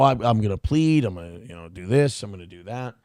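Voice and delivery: lightly voiced mumbling wolf of Wall Street; a hint of the movie stockbroker; Jordan Belfort bit. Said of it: "Jordan Belfort thing".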